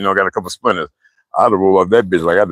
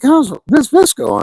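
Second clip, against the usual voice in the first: cheeky reply; slower than usual. Retold or imitated. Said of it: "I was like".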